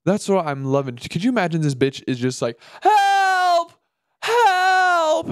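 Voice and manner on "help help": shrill, much higher, shouted help; hammed-up screaming for rescue; a lost woman yelling into the bush, played for laughs; mock cry for help. Imagining lost woman calling for help.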